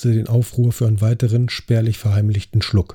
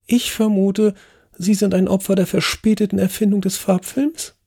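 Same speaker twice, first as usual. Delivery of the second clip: put-on much higher, kindly old lady; Lady Agatha sympathetic; 10 semitones higher than usual. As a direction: ask gently with pity. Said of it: "erkundigte sich Lady Agatha mitfühlend".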